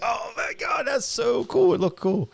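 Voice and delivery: high-pitched, gushing awe; fanboy excitement. Retold or imitated. Host retelling seeing the Wolverine outfit, fanboy excitement. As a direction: geek out, reliving the first sight. Retelling own delight at costume.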